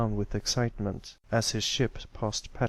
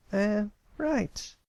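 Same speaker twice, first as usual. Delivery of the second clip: high filly voice, reluctant agreement; fibbing filly; 11 semitones higher than usual. Acted. Sweetie Belle fibbing to Scootaloo, reluctant high filly voice. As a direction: agree without meaning it. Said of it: "Sweetie Belle fibbed".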